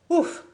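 high loud relieved ouf; relieved exclamation. Acted. Fabien finishing freeing his friends, relieved ouf. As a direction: blow out a big ouf of relief. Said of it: "s'écria-t-il".

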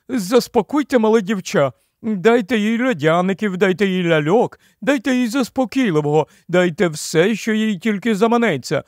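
high, cooing, flustered queen voice; effusive royal pity and bustling orders; fussing giant queen. A narrator's reading. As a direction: fuss over a crying child, pitched high.

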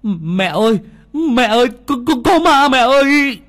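put-on high, loud child screaming for mom; terrified cry. A narrator's reading. Frightened boy screams to his mother about a ghost, terrified. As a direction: shriek for your mother in panic. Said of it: "kêu lên".

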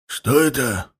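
hoarse, alarmed. A narrator's reading. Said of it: "тревожно сказал сиплый голос".

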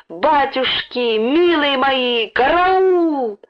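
high, drawn-out wail of alarm; wild piercing yell. A narrator's reading. Woman finds her padlock gone, wild piercing wail. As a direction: cry out piercingly, stretching every word. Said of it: "диким пронзительным голосом заорала".

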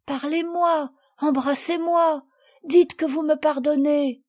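put-on high-pitched, imploring child; pleading, emotional entreaty; crying out. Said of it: "s'écria Marguerite".

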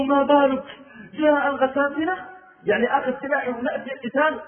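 high, loud urgent questioning; alarmed question. Retold or imitated. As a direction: demand what is happening. Omar asks alarmed.

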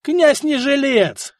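high, slow shout. A narrator's reading. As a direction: shout it over the hall. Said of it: "крикнул Живила".

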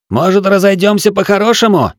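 high, loud shouted offer; shouting over wind. A narrator's reading. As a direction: call out over the gale. Calling across to enemy in storm.

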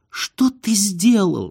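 high, slow, aghast question; dismayed, stretched-out disbelief; alarmed. A narrator's reading. Wife seeing the ration card torn, alarmed.

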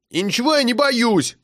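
put-on high indignant child's shout; boy shouting at his teasing cousin. A narrator's reading. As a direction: shout back defiantly. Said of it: "крикнул Серёжа".

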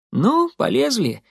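high, lightly voiced Molly; Molly urging on. A narrator's reading. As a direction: pitch it up as an eager prompt. Молли зовёт лезть через ограду.

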